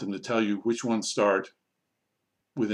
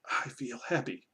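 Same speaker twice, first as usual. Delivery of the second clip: lightly voiced puppet, softer reply; puppet answer; 8 dB quieter than usual. Shown as a demonstration. Teacher answering as the puppet, softer voice. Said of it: "you have the puppet and go".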